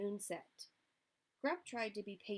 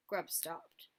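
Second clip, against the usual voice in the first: ordinary delivery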